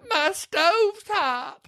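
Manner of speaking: high, slow, hammed-up crying; mock sobbing. Retelling a sad movie scene, mock sobbing. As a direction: wail it like a fake sob.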